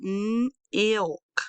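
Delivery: m ilk with long m, then a gap; sounded-out onset. Shown as a demonstration. Teacher breaks down milk, m ilk in parts. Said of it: "next is the I, I, I sound".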